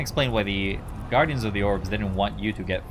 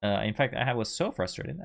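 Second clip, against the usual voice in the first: ordinary talk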